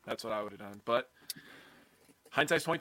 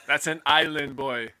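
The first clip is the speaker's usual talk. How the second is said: lightly mocked island-boy voice, loud and drawn out; slow, loud, silly imitation; stupid voice; 3 semitones higher, 8 dB louder than usual. Co-host quoting a player's Instagram comment in a stupid voice. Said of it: "say that in their stupid voice".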